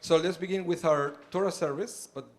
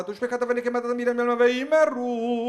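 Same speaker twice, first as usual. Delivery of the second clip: fast, high chanted Kaddish; rapid prayer recitation; 9 semitones higher, 11 dB louder, much faster than usual. Acted. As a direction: rattle the Kaddish off quickly.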